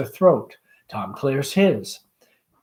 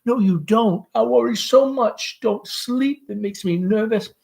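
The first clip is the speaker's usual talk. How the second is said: higher, fretful motherly voice; high nervous Southern mother; anxious mother Amanda; 8 semitones higher than usual. Acted. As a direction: fuss over her son, pitched up.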